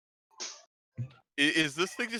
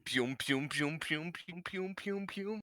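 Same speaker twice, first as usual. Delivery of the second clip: pew pew zaps climbing higher and softer; rising pew pew; 7 semitones higher, 4 dB quieter than usual. Pretend. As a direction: fire off little laser sounds. Player makes blaster noises.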